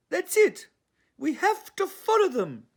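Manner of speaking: grand posh English resolve, like an actress. Acted. Honey announces the plan to Leon in a high, loud posh English voice. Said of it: "a very posh English accent".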